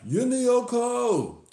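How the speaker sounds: high, loud, drawn-out scream; acted scream. A narrator's reading. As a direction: scream it long as a desperate captive. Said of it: "He screams something that sounds like".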